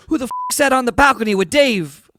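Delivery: loud, high, baffled punchline; astonished shout from a bystander; incredulous outburst. Acted. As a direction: blurt it out in disbelief.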